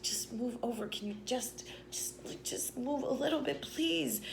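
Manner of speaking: urgent high, half-whispered begging; hushed plea. Retold or imitated. To the guy who wouldn't move, whispered pleading. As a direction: plead under your breath, squeaking softly. Pleads quietly with seat neighbour.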